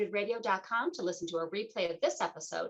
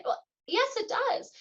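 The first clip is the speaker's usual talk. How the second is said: high, emphatic "well, yes, it does"; emphatic correction. Acted. Host firmly correcting a common belief, high and emphatic. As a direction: pitch it way up to insist. Mock pushback, high exclaim.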